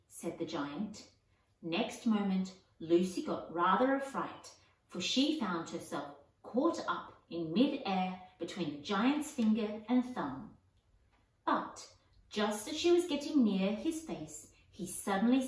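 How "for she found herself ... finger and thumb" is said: usual speaking voice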